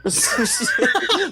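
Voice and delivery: sudden squealing laugh; high burst of laughter. Real, not acted. Laughing at the lore.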